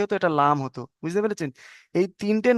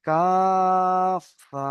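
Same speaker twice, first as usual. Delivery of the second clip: very slow recitation of letter names; drawn-out letter recitation; much slower than usual. Shown as a demonstration. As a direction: stretch each letter name long and sung. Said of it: "তাহলে কি হবে?".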